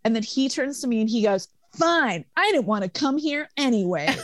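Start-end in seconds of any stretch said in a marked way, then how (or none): 1.8-4.2 sulky comeback. Retold or imitated. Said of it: "middle school comeback".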